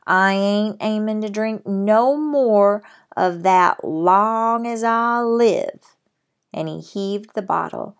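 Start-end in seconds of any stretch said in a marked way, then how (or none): 0.1-6.0 loud, slow, drawn-out Texas cowboy vow; slow Texas accent. Acted. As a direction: stretch each word in a stubborn Texas accent. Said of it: "in his strong Texas accent".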